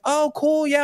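host answering the fan; mock polite self. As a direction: play it polite. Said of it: "I was like".

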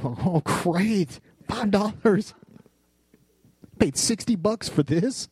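high, mocking sarcasm; sarcastic five-dollar grumble; sarcastic complaint. Sarcastic reaction to reward.

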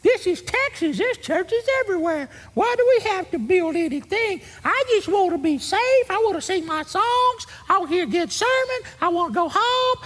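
very high whining mock voice; mock the fearful member, whiny; whiny churchgoer. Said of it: "you're saying".

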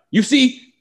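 put-on Israeli accent, high; a hint of Netanyahu's Israeli accent; Bibi impression. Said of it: "Bibi, put it".

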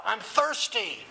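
calling out. Retold or imitated. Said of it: "he calls out".

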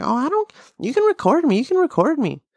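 high mocking voice. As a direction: taunt the camera.

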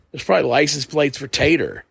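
ordinary talk

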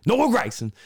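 high, punchy name call; mock hype name-drop. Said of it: "He's like".

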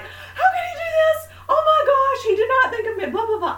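high, loud, shrill-edged crying rant; retold furious rant. Wife fuming over her blocked car, high crying shout. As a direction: wail it out like a wronged wife. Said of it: "I'm like".

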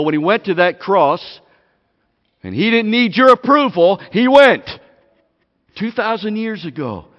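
loud, pounding preacher's voice; fiery sermon build; full-force preaching. Acted. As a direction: hammer each line home to the room.

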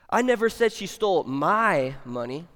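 "my" loud and stretched long; stress on MY. Shown as a demonstration. Preacher showing another meaning, stress on "my". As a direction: lean heavily on "my" before "money". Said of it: "depending on which word you emphasize".

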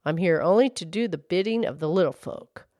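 low-voiced storybook horse. A narrator's reading. Horse explaining its duty to the girl, low voice. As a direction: drop the pitch for the horse.

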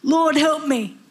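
high pleading cry; acted desperate plea; begging. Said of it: "She begged him".

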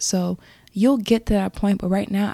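ordinary talk

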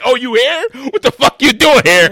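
high, far-off put-on voice demanding answers; high mock "what are you doing here"; mock surprised confrontation. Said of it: "it's like".

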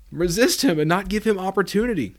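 rising preacher's exhortation; preaching push. Acted. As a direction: drive it home from the pulpit. Preacher urging congregation.

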